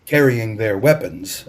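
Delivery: plain reading voice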